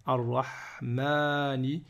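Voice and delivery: "Arrahmani" very slowly, the second a held long; slow reading of "Arrahmani", doubled r and long a; long alif pronounced. Shown as a demonstration. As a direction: stretch the "maa" in "Arrahmani". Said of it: "il faut quand même le prononcer".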